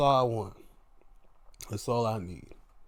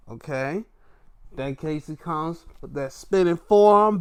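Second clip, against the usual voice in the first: ordinary talk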